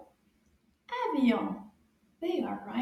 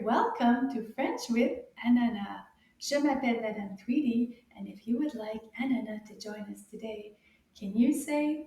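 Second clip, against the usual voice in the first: ordinary talk, nothing marked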